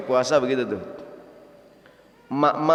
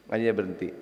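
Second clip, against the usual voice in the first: much lower and softer, a deflated aside; 8 semitones lower, 9 dB quieter, much faster than usual. Acted. Preacher repeats the punchline to the congregation, low and deadpan. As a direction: let it drop like a letdown.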